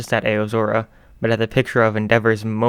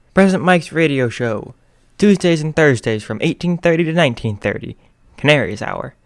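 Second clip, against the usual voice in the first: higher, louder radio host read; radio announcer; 5 semitones higher, 6 dB louder than usual. Acted. Radio show intro read.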